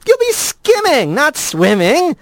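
skimming and swimming contrasted, swimming slowed; rat voice. A narrator's reading. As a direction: play on skimming versus swimming. Rat jokes, contrast words.

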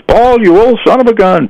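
high, hearty imitation of an old pal; acted-out jolly exclamation; jovial ribbing. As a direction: razz an old buddy affectionately. Truman's jovial farewell to his buddy.

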